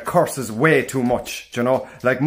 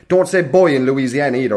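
ordinary delivery

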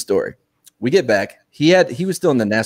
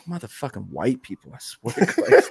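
much softer drill sergeant grumble, rising at the end; 3 dB quieter than usual. Retold or imitated. Platoon sergeant hears what happened, exasperated drill sergeant grumble. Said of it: "he's, like, really drill sergeant E".

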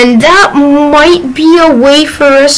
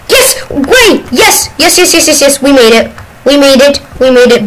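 excited cheering; 3 semitones higher than usual. Real, not acted. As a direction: cheer like you just escaped. Escape succeeds, cheering.

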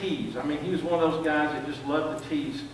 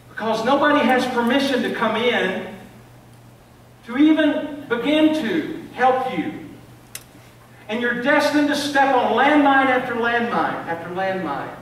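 higher and louder, pressing the congregation; preaching at full force; 7 semitones higher, 9 dB louder than usual. Real, not acted. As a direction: drive it home like a preacher.

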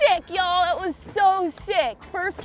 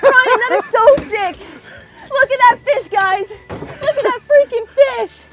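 high, loud, thrilled shouting; real exhilaration at full voice; excited yelling; 6 semitones higher, 8 dB louder than usual. Real, not acted. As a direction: yell in amazement at the catch. Celebrating friend's giant catch.

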